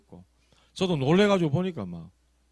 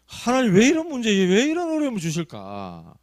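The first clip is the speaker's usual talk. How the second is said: raised, louder cry to God; anguished plea; 8 semitones higher, 6 dB louder than usual. Acted. Pastor acting out his lament to God, loud and high. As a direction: lament as if pleading heaven. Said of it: "진짜 그런 마음이 드는 거예요".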